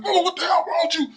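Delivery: high, loud exasperated yell; retold angry yell. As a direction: throw the question at her, fed up. Retold quarrel, man yelling at woman.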